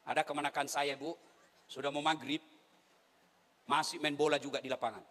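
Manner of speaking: normal talking voice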